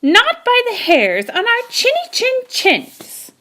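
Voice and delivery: high defiant pig voices; defiant little pigs. A narrator's reading. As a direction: refuse in a high squeaky voice. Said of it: "answered the little pigs".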